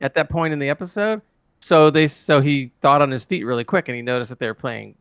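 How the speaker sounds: normal talking voice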